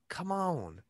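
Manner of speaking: slow drawled "come on"; a Southern-leaning stab at Biden; too Southern take. Acted. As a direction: overdo the Southern stretch on "come on". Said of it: "That was too Southern".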